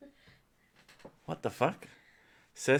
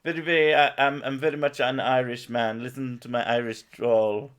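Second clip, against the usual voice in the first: lightly voiced posh Irish gent, a bit higher and louder; mock posh Irish; 4 semitones higher, 6 dB louder than usual. Mocking the clean Irish to a friend, light posh Irish. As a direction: ham up a prim clean Irishman. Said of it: "They're the ones that".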